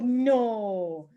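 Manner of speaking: lightly voiced grandma sympathy; a gentle drawn no, no; imitating grandma. Teacher imitates grandma cutting in with a lightly voiced no. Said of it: "And she'll be like".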